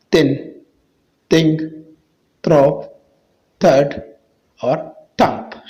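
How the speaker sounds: word list with TH hardened to t. Shown as a demonstration. Teacher drilling TH words for viewers, t sound for TH. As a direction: say each word alone, TH as in "ता". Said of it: "ता का आवाज़ देता है".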